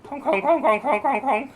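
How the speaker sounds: high loud clown honking; silly clown. Pretend. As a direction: honk like a goofy clown. Clown honking during game.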